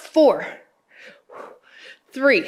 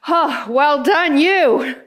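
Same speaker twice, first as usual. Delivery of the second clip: excited praise; 6 semitones higher, much slower than usual. Real, not acted. Praising after last rep.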